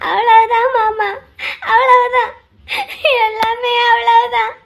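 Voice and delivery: slow, drawn-out sobbing wail; crying. Acted. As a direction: draw the words out through tears. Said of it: "எதுக்கு மச்சி அழுகுற". (இழுத்து இழுத்து அழுகை)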